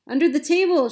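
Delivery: high, loud scream; panicked scream. A narrator's reading. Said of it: "she screamed".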